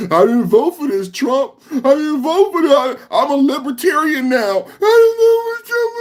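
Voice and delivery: high, whiny mock-sobbing voice; mock crybaby whine. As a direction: whine it like a crying baby. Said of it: "crying on conservative media".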